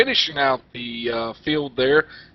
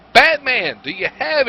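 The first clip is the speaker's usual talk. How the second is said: calling out; 12 semitones higher, 4 dB louder than usual. Real, not acted. Calling to the pit reporter.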